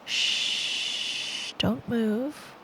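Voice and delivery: ordinary delivery, nothing marked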